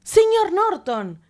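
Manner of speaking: mucho más agudo y lento, efusivo; emocionado. Acted. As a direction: saluda con alegría desbordada. El rey saluda emocionado. (Colin saluda a sus amigos, emocionado)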